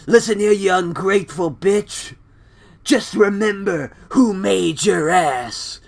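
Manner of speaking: loud, high, badly imitated wrestler voice; hammed-up angry shout, slowing at the end; Triple H. Snapping husband grabs the microphone, in a bad impression. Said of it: "that's my Triple H voice".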